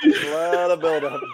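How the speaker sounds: laughing tease. Real, not acted. Teasing the cow attempt.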